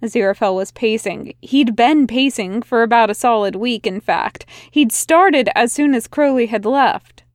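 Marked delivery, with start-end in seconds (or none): none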